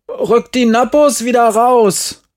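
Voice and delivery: high, loud demand; indignant child calling out; shouted demand. A narrator's reading. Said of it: "rief ich also".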